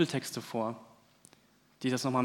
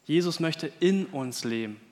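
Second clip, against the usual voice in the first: usual speaking voice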